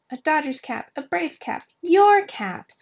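building from a high call to a loud shout; kids shouting demands. A narrator's reading. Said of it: "the kids shouting".

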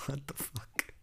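whispered shock under the breath; shocked whisper as the lights die; startled whisper. Real, not acted. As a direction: hiss it out in disbelief. Reacting to sudden power cut.

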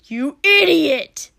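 high, very loud, drawn-out yell; angry snap. A narrator's reading. Katsuki scolding his friend, angry snapping yell. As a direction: explode at a reckless friend. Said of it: "Katsuki snapped".

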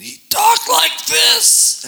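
strained, scratchy, choked voice, high and loud; imitating a pastor's ruined voice; damaged voice imitation. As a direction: force the words out like your vocal cords are shot. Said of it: "That's what he sounded like".

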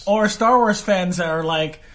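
normal talking voice

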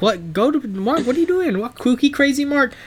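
parents voice. Retold or imitated. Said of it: "They'd be like".

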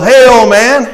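loud, high-pitched acted hail; brash traveler. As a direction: call out pushily as the second traveler. Second, disgruntled traveler calls out.

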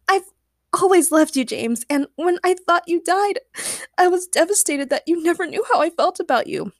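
high-pitched earnest confession; lovelorn girl. A narrator's reading. Agnes confesses her love to James, gushing. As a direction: pour out the heart in a girlish voice.

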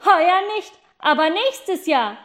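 high, loud call; boy calling down to the children, high and loud. Acted. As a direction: shout the promise down.

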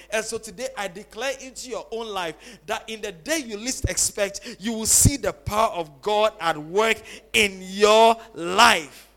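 preacher swelling to a booming finish; preaching declaration. Acted. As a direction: declare it over the congregation with mounting force.

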